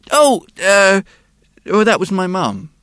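put-on high, loud child's voice; a small boy answering the policeman; little boy voice. A narrator's reading. Boy's voice in story.

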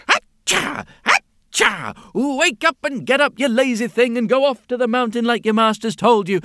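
squeak-shout at the lazy donkey; bossy bunny. A narrator's reading. Bunny rabbit chasing the sleeping donkey, high and loud.

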